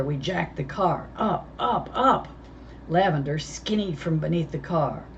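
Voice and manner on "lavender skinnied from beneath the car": plain reading voice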